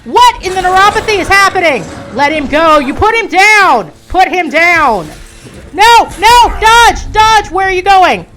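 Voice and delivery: loud, high panicked commands; frantic yelling. Real, not acted. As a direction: bark orders in a panic. Frantic yelling during chase.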